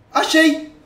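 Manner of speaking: thrilled, loud and high; excited shout. Real, not acted. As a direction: yell it out like you just found it. Found the girl's photo.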